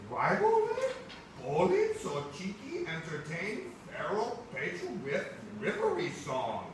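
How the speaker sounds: high, loud cartoonish put-on cousin voice; exaggerated high cousin voice. Teacher reading the comic to the class, high cartoonish cousin voice. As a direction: play the visiting cousin big and squeaky.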